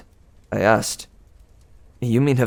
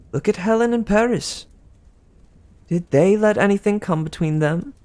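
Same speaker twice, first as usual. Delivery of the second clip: higher, sweet gossiping goddess; Aphrodite; 10 semitones higher than usual. Acted. Aphrodite teasing Percy about Annabeth, higher and sweet. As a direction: coo it like a doting goddess.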